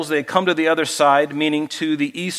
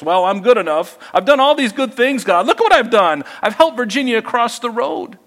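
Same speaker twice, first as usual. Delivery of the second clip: smug braggart; 7 semitones higher than usual. Retold or imitated. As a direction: show off to God like a proud child. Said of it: "not about all my good works".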